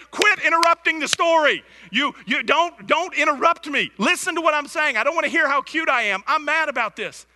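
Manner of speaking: high, loud mock scolding; imitating angry wife. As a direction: rant like a flustered, cross wife. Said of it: "And she's like".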